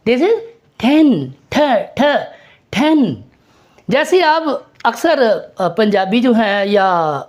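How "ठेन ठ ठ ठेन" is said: ten and ठ with a hard puff of air; aspirated T drill. Shown as a demonstration. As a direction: isolate ठ, then put it back into ten. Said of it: "टी को ठ".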